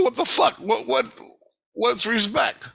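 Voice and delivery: high, loud, stammered question; incredulous high-pitched demand; exasperated bewilderment. Retold or imitated. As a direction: retell your confusion at high pitch.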